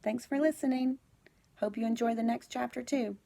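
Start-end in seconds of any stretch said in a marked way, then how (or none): none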